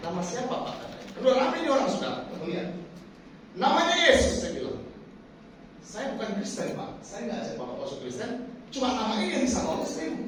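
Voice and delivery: usual speaking voice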